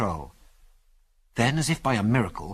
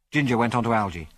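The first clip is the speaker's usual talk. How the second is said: ordinary delivery, nothing marked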